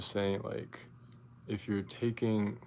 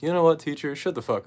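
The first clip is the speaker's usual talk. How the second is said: loud, higher mock shout at the teacher; telling off teacher; 6 semitones higher, 10 dB louder than usual. Student finally telling the teacher off, a loud mock shout. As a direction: snap back hard at her. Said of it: "I'm the only person that was finally like".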